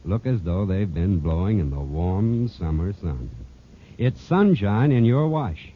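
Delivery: normal talking voice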